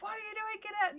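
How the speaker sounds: high sharp retold yell. Yelling at muddy family.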